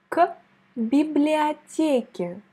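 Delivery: библиотеке slowly; slow model word. Shown as a demonstration. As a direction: say библиотеке slowly for the learner to repeat. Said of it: "prononce avec moi ce mot". (библиотеке lentement, à répéter)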